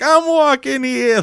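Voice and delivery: put-on high, slow New York accent yell thrown back; a driver mocks them in a New York accent; New Yorker yell. Driver yells back out his window in a New York accent. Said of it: "he yells it back at us".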